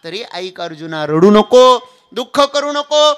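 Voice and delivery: loud, high, slow sermon cry; full-force preaching. Acted. Preaching Krishna's words. (कीर्तनात जोरकस, संथ प्रवचन)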